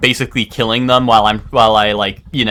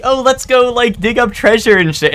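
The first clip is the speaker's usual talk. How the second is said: high, mocking voice of eager friends; imitating friends chirpily suggesting treasure hunting; 11 semitones higher than usual. Friends proposing treasure hunting, mimicked in a high, mocking voice. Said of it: "they'd be like".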